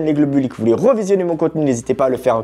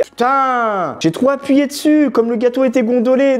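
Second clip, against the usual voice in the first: ordinary talk, nothing marked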